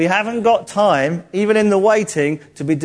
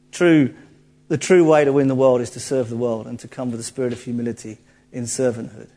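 normal talking voice

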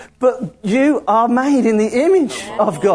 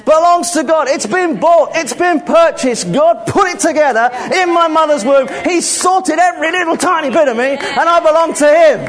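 raised, emphatic preacher's declaration; forceful preaching; 5 semitones higher than usual. Real, not acted. Preacher claiming the body for God, forceful. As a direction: declare each item firmly, with rising intensity.

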